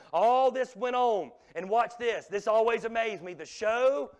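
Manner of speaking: loud, driving pulpit preaching; a preacher rising to full voice; preaching at full force. Acted. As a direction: hammer it home to the congregation.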